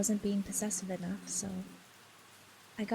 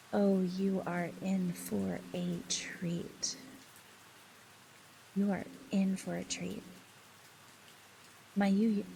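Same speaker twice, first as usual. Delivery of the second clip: slow teasing; slower than usual. Real, not acted. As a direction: savor each word like a secret. Teasing announcement to chat.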